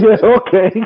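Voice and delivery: amused, giggly okay; laughing reply. Retold or imitated. Friends agreeing with a laugh, amused. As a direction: chuckle through it as if they can't wait. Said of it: "They said".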